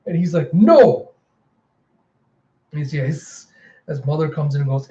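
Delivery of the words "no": high, loud acted cry of refusal; desperate shout. Andy refuses amid the struggle.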